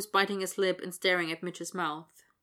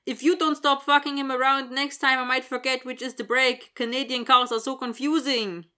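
hammy mock Swedish accent, louder; badly faked Swedish accent; 7 semitones higher, 8 dB louder than usual. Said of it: "Dylan says in a bad Swedish accent".

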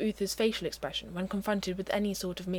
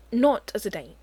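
louder, firm and clear; very firmly; 2 semitones higher, 7 dB louder than usual. A narrator's reading. Merlin making clear to Uther it's no date, firm and loud. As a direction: lay down the condition firmly. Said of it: "very firmly".

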